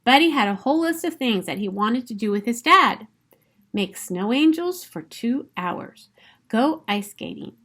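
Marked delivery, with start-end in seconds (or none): none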